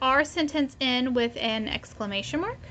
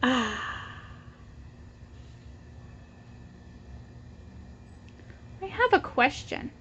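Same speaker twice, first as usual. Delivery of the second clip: put-on reading to children; 5 dB quieter than usual. Teacher reading the Pigeon book aloud to her class. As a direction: read it out for little ones, pausing for them to echo.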